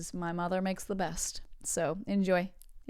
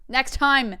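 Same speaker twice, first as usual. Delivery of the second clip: sudden loud, higher outburst; mock frustrated shout; 5 semitones higher, 9 dB louder than usual. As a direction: shout it in playful exasperation. Mock outburst at failed impression.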